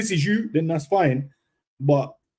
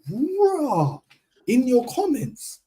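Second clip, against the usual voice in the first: much higher, drawn-out disbelief; mock incredulous bruh; 11 semitones higher, much slower than usual. Telling a commenter off, high and slow mocking disbelief. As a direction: stretch it out high like you can't believe it. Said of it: "I said".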